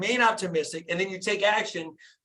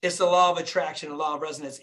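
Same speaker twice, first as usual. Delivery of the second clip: normal talking voice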